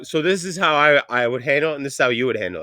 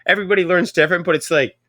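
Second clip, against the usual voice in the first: ordinary talk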